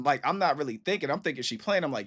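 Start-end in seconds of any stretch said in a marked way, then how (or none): none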